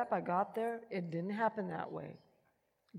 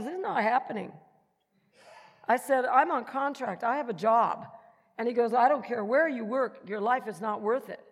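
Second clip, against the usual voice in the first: heated argument; 4 semitones higher, 8 dB louder than usual. Retold or imitated. Arguing with doctor in retold scene.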